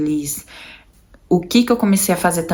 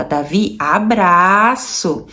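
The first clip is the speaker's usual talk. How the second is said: ensinando ao filho; much slower than usual. Retold or imitated. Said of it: "colocava ele na frente do pai dele e falava".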